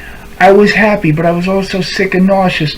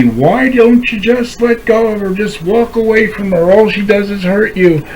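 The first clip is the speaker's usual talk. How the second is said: mock nagging; 6 semitones higher than usual. Quoting nagging friends again.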